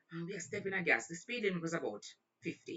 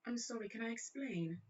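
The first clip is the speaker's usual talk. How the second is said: higher, softer mock-British plea; British accent; 5 semitones higher, 6 dB quieter than usual. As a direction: apologise in dainty put-on British. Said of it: "broke in a one British accent".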